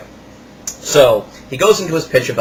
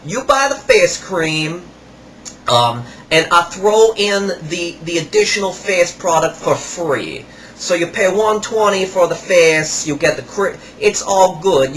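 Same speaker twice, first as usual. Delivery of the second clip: higher, slow put-on salesman voice; imitating the salesman; 4 semitones higher, slower than usual. Kiosk salesman rubbing salt on a customer's hands, imitated higher and slower. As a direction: ham up the pushy kiosk seller. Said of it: "it goes something like this".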